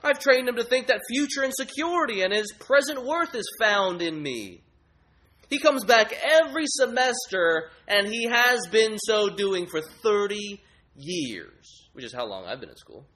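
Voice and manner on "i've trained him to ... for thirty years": much higher and louder, lofty idol; grades idol. Acted. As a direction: sneer like a lofty scholar. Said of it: "He says".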